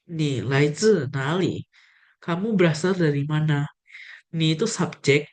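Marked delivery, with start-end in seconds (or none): 0.1-1.7 slow repeated model question; example question. Shown as a demonstration. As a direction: say each word slowly for learners. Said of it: "ada contoh kedua".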